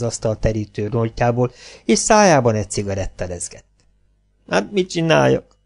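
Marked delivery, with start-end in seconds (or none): 4.5-5.6 higher, helpless lament; helpless whining. A narrator's reading. Actress wails helplessly about her trouble, higher and whiny. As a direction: moan it like nothing can be done. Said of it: "siránkozott tehetetlenül".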